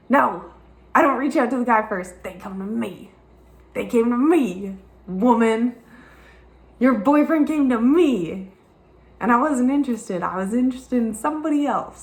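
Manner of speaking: high, loud mock defiance; defiant retort. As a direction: snap back at the gossip, half-acted. Defiant comeback to gossiping woman.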